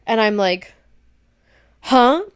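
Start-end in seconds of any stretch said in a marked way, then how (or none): 1.8-2.2 confused huh. Retold or imitated. Coming to, confused.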